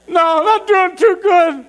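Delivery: high, slow strained holler; acted helpless wail, stretched out; distressed call back. Man stuck in bathroom answering his friend, wailing back.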